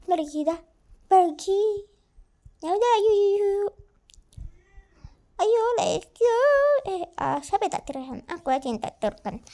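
ordinary talk

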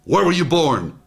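lightly sharpened official question; too sharp. Shown as a demonstration. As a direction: fire it like a mild mock interrogator. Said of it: "Too sharp".

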